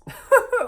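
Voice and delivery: short high burst of laughter; a sudden squeaky laugh; incredulous laugh. Real, not acted. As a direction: laugh it off in disbelief. Laughs at fight over drinks.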